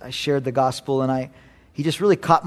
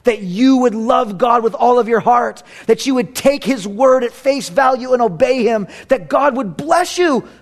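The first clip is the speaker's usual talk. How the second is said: fervent preaching; 8 semitones higher, 4 dB louder than usual. Acted. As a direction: build to a climax. Preaching climax.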